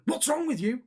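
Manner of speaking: high, mock-indignant demand; retold angry challenge. Driver challenging him from the car, mock-angry. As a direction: imitate an aggrieved stranger calling out.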